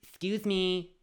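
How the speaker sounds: high, loud pretend outburst; hammed-up yell at the muggers; mock defiance. Imagined telling off the muggers, mock loud yell. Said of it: "I'm not about to go".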